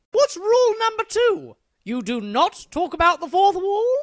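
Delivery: high, loud, eager guess; eager questioning. Acted. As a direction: jump in like an overkeen pupil. Eager pupil guessing the next rule.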